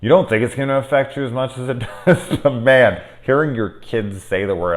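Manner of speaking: ordinary delivery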